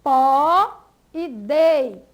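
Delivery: pó and dei slow, loud and separate; stressed syllables. Shown as a demonstration. Teacher listing the tonic syllables, slow emphatic pó and dei. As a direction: punch out pó, then dei, each alone. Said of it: "elas são pronunciadas com mais força".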